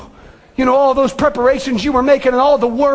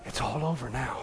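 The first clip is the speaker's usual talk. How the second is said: much softer and lower, nearly breathless; hushed whisper; 13 semitones lower, 17 dB quieter than usual. Acted. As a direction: say it like a final blessing. Jesus' closing words.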